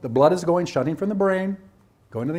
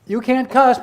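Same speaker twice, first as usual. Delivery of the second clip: loud, higher stern rebuke; scolding; 6 semitones higher, 8 dB louder, slower than usual. Retold or imitated. Teacher acting out telling a student off, loud stern. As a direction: snap the rule out sharply. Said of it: "the minute I say".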